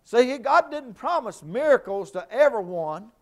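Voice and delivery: loud, high, driving preacher's cry; full-force preaching. Acted. Preacher rousing the congregation, shouting high and loud.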